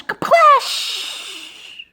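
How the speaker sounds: high-pitched imitated splash; splash noise. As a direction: burst out a high "kaplash". Imitates the fish hitting the water.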